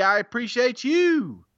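showy sign-off to a reviewer; catchphrase flourish. Real, not acted. Same catchphrase to next reviewer.

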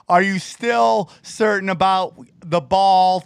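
ordinary talk, nothing marked

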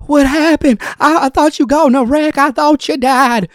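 high, loud distraught questions; frantic grandmother sure the worst happened; imitating a hysterical worried voice; Mimi panicking. Mimi fearing a wreck.

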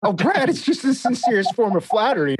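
high, sing-song mock innocence; mock innocent. [name] retells teasing Brad, high mock innocent voice. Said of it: "And I go".

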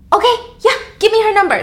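high, loud eager grab; eager jump. Acted. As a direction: pounce on the offer.

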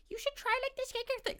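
high put-on sweet voice; high mocking impression of a skincare fan. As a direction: imitate a pushy skincare fan, sugary. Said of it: "and being like".

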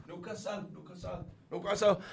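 softly practising the Geordie sound; attempted Geordie accent. Shown as a demonstration. Guest warms up on the city name, attempted Geordie accent. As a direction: rehearse the accent under your voice, Geordie. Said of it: "give me a sentence to say Newcastle".

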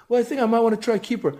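put-on high young boy voice; kid voice. Son answering dad, innocent kid voice. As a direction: innocent eight-year-old. Son's line in dialogue.

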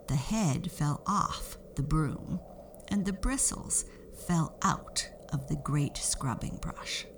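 usual speaking voice

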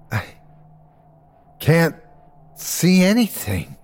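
halting words, long pauses between. A narrator's reading. Dizzy narrator to Terry, halting. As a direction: let words fall out one by one. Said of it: "Words fell out of my mouth".

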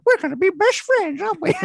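high cooing new-friend voice; nanny impression. Mocking the new nanny to his wife, high and gushing. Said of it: "I just suddenly did an impression of her".

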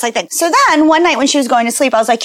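ordinary talk, nothing marked

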